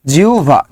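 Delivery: জিহ্বা said as "jiu-va"; correct pronunciation. Shown as a demonstration. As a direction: turn the hb of জিহ্বা into a u glide plus v. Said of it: "কি হবে বলেন তো".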